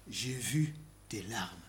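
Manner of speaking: soft, slow and low; tender. Real, not acted. Preacher quoting God to Hezekiah, soft and slow. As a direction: whisper God's comfort gently.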